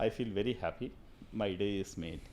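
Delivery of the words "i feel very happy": ordinary talk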